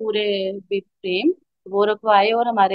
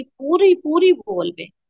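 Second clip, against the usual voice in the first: astonished; 6 semitones higher, slower than usual. Retold or imitated. Telling the congregation about the wall, astonished. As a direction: pitch jumps up on पूरी, then lingers slowly.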